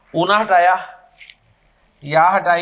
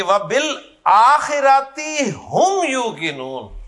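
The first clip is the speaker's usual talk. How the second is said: slow, measured Arabic recitation of وَبِالْآخِرَةِ هُمْ يُوقِنُونَ; Quranic recitation; 5 semitones higher, slower than usual. Shown as a demonstration. Teacher recites the verse for his class, slow and measured. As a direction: recite the verse slowly, each word weighted. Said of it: "وہ اس انداز میں کی ہے".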